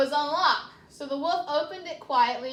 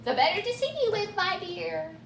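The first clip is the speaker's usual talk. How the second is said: higher, fast sweet granny voice; wolf in granny voice; 5 semitones higher than usual. Acted. Wolf pretends to be grandmother, sweetly high. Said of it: "said the wolf".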